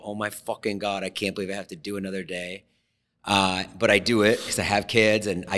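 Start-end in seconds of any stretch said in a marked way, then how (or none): 0.0-2.6 weary mutter. Retold or imitated. Grumbling about another day.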